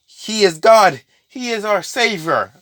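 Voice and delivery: hammed-up follower preaching his god; mock prophet. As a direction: proclaim it like a zealous prophet. Prophet proclaiming.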